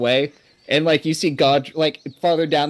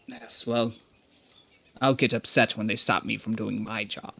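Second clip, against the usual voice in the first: lower, softer grumbling reply; low retort; 6 semitones lower, 8 dB quieter than usual. Acted. Traveler answers the official, low and soft. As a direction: grumble it back low and quiet. Player character retorts to NPC.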